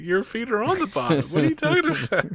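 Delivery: high-pitched incredulous retort; mock exasperation. Adults to the kid panicking in the pool, mock exasperation. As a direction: imitate a baffled grown-up scolding.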